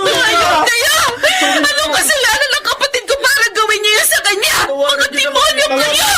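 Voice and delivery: high, raging shouts with sobs; furious screaming. Acted. Sister screaming at her sister's attackers in jail. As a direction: scream curses at them through tears.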